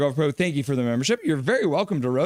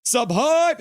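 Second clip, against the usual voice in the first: high whoop then high sub hype; hyped cheer; 11 semitones higher, 3 dB louder, much slower than usual. Retold or imitated. Voicing a viewer's hype, a high whoop. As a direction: hype it up like a chatter. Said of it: "And Andrew's like".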